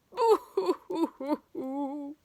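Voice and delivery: high, loud hammed-up sob; loud boohoo wail; mock wail. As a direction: blub like a cartoon crybaby. Family bobbing, sobbing.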